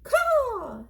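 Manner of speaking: very high cawing crow. Acted. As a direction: shriek it like a crow. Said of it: "said the Pellegrina crow".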